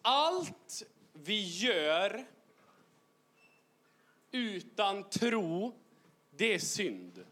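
very slow, drawn-out words with long pauses; solemn slow delivery. Shown as a demonstration. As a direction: let each word land slowly. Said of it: "Bibeln säger det". (långsamt och högtidligt)

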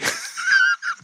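high shrieking burst of laughter. Real, not acted. Host bursts out laughing at his own mock threat, very high. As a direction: cackle at your own joke.

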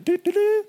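high sung-out horn fanfare; mock self-congratulating fanfare; playful horn toot. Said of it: "trying to toot my own horn".

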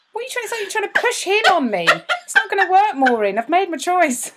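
refusing the matchmaking with hammed-up indignation; indignant retort. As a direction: play up the mock outrage at her friend. Retorting to Maureen's push.

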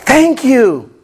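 mock apologetic thank-you; sheepish thanks. Woman thanking the man who paid, apologetically. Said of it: "she apologetically just".

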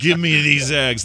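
hammed-up tough-guy demand; mock demand. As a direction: growl it like a joke threat. Mock threat to cashier.

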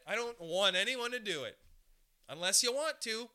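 ordinary delivery

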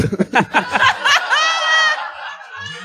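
howling laugh at the reveal; shrieking laugh. Real, not acted. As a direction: let out a big shrieking laugh. Big laugh at the twist.

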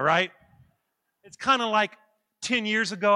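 ordinary delivery

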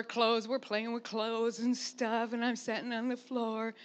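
usual speaking voice

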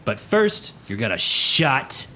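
loud, drawn-out scolding; stern first mate. Acted. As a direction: lean hard on each word of the order. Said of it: "Mister Plug stares directly at you".

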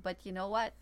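ordinary talk, nothing marked

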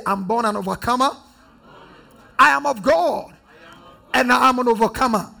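congregation repeats it back. Real, not acted. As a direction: declare it loud with the crowd. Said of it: "Somebody say".

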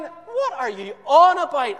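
high, loud worried wife; Haman's wife phoning him from home; wife on the phone. Acted. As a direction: call him, anxious, on the mobile.

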